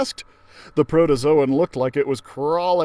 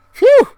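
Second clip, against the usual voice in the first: very high, loud, gasped phew; big sigh of relief after the escape; relieved phew; 15 dB louder than usual. Acted. Narrator kid relieved after the escape, loud high phew.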